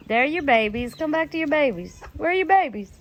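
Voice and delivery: ordinary delivery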